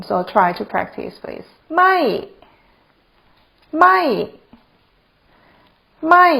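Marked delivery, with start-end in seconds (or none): 1.8-6.3 ไม่ said alone with a falling tone. Shown as a demonstration. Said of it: "is also falling tone".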